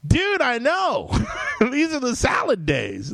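hammed-up excited dude; mock giddy outburst. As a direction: burst out like a thrilled kid. Mock answer to Claremont's advice.

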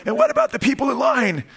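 high-pitched pestering kid impression; little boy's scratchy voice. Preacher imitates little Justice pressing mom, high scratchy child voice. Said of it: "in his little scratchy voice".

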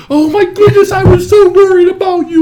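put-on high, loud, gushing baby-talk voice; mock doting owner. Husband imitates wife fussing over cat, high loud gushing. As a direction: gush over the lost cat at full volume. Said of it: "You're like".

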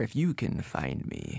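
slow, soft spooky trailer voice; eerie eighties TV intro read; spooky announcer voice. Acted. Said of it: "you sound very spooky there".

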